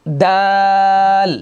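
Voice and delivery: long held model dal; drawn-out dal. Shown as a demonstration. Teacher stretching দাল slowly for the class to repeat. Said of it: "আমার সাথে সাথে বলবেন".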